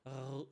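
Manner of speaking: R drawn out as an attempted roll, softly. Shown as a demonstration. French speaker showing what they wouldn't say, rolled R. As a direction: stretch R into a long rattle. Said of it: "We wouldn't say".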